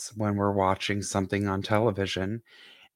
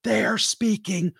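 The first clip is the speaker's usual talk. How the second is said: high, stretched-out indignant protest; mock exasperation; 9 semitones higher than usual. Retelling Alan going nuts at the TV, mock exasperated. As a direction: imitate someone going nuts over subtitles. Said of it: "Alan goes nuts".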